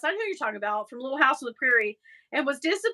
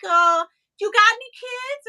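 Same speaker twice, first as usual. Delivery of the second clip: higher, lightly voiced New York accent; 9 semitones higher, 5 dB louder than usual. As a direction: ask like a nosy New Yorker. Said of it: "weird New York".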